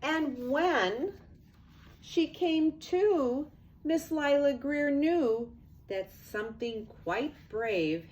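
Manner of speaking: lively storybook read-aloud; sing-song picture book narration; reading to children. A narrator's reading. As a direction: read it like bedtime rhyme to kids.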